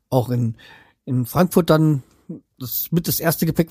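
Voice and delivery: ordinary talk, nothing marked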